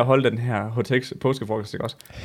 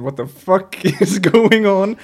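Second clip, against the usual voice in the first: slow, higher, laughing disbelief; inner voice; 6 semitones higher, 5 dB louder, much slower than usual. Retold or imitated. Inner voice at the party, slow bewildered laugh. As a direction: drawl out the baffled thought with a laugh. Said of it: "op i mit hoved, der står bare sådan".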